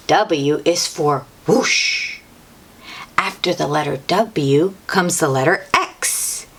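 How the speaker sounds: ordinary delivery, nothing marked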